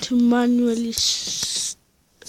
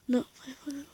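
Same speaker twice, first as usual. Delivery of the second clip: whispering; 2 semitones higher, 9 dB quieter than usual. Real, not acted. Quick self-correction under breath.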